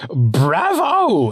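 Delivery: put-on very high, loud, childish Bravo; squealing imperial delight; gleeful emperor. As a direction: cheer like a spoiled boy-king. Emperor cheers.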